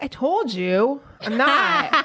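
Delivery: slow, smug I told you so. Retold or imitated. Quoted back to the nurse, slow and smug. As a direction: lean on it like you won the bet. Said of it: "And I'm like".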